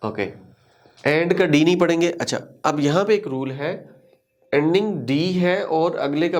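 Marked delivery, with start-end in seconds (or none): none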